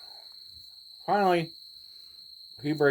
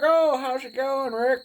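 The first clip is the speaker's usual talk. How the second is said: much higher, slow friendly cop voice; the cop greets Rick like an old pal; cop greeting; 7 dB louder, slower than usual. Retold or imitated. Cop greets dad he knows.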